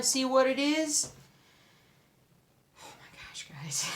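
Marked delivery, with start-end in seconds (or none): 2.8-3.9 soft unvoiced gasp of awe; breathless whisper. Real, not acted. Vlogger about to show viewers the pattern, whispering. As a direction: breathe it out under your breath.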